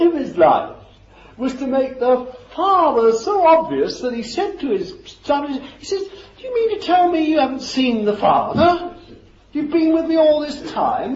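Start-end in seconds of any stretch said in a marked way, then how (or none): 6.0-11.2 higher and louder, disbelieving; incredulous rebuke. Retold or imitated. Jesus asking his disciples, incredulous and raised. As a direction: ask it as if they should already know.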